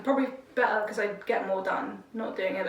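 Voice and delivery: ordinary talk, nothing marked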